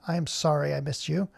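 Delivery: ordinary talk, nothing marked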